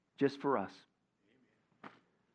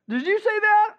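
much higher, louder, startled question; scared burglar; 10 dB louder than usual. Acted. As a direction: jump in panic at the voice. Frightened burglar.

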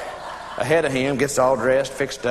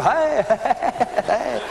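high, drawn-out mock gushing; gushing greeters; 4 semitones higher, slower than usual. Guests greeting a woman in a homemade shawl, gushing. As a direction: lay on the party compliments, slowing at the end.

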